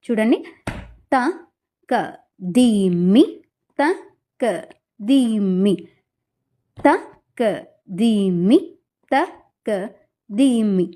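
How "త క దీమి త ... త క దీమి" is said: two syllables per beat, త క దీమి in pairs; second speed recitation. Shown as a demonstration. Teacher demonstrating second speed of the jathi. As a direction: chant "త, క, దీమి" with దీమి run together on one beat.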